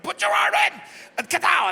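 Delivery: high, very loud yell; acted full-force bellow; straining shout. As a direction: shout it mid-struggle. Acts himself yelling while pulling strap.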